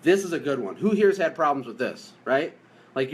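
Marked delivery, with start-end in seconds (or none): none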